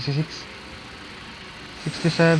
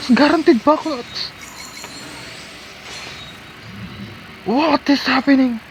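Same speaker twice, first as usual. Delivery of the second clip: amazed; 5 semitones higher than usual. Real, not acted. Getting double pulls.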